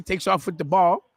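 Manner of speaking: ordinary talk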